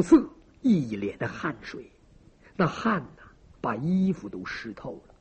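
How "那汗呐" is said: ordinary delivery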